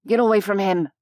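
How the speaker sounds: restrained, forceful warning yell; angry yell. A narrator's reading. Human defends the fallen Unseelie, angry yell. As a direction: order them off him. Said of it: "the blue-haired human yelled".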